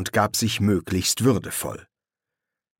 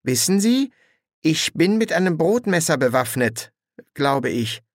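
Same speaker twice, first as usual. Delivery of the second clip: uncertain warning; 7 semitones higher than usual. Acted. Young woman warns two strange men she is armed, uncertain. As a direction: warn them, then lose certainty.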